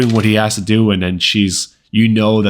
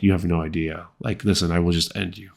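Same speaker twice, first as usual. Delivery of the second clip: soft, offhand menace; quiet, unbothered warning from someone powerful; nonchalant threat; 2 semitones lower, 6 dB quieter than usual. Shown as a demonstration. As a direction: throw the threat away casually. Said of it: "I feel like you would be nonchalant".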